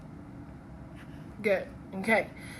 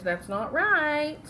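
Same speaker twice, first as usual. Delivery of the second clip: higher, drawn-out mock protest; objecting pupil; 6 semitones higher, slower than usual. Pupils correct their teacher, imitated as drawn-out protest.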